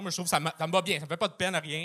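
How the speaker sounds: usual speaking voice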